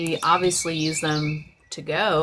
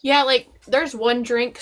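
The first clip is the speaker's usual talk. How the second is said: mouth full; 5 semitones higher than usual. Real, not acted. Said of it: "sorry for talking with my mouth full".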